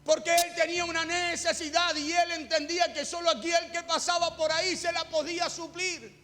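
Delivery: impassioned preaching. Acted. Preacher retelling blind Bartimeo's plea to his congregation, impassioned preaching.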